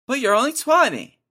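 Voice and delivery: put-on high-pitched ribbing of a twenty-year-old; mock disbelief. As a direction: jump way up in pitch in fake outrage. Teasing the snoring roommate.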